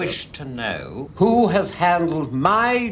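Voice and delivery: indignant demand. Acted. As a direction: slowly spell out his outrage.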